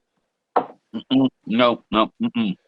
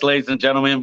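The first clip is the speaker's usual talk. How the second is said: ordinary delivery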